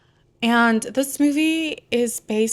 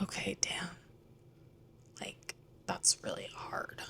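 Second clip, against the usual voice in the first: whispered retort; 17 dB quieter than usual. Retold or imitated. King's reluctant reply to queen.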